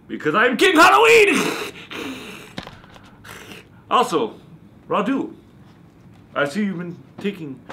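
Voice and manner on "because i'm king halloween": high, hammy shout; theatrical vampire bellow; triumphant proclamation. Acted. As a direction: crow it like self-declared royalty.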